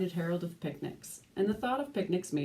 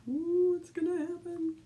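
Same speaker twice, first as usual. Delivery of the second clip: high, wide-eyed ooh; mock suspense; 10 semitones higher than usual. Reading to a child, playing up a gasp of suspense. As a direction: tease the kids with suspense.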